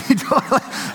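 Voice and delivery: high real laugh. Real, not acted. Father laughing at his son's line, high real laugh. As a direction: burst out laughing at it.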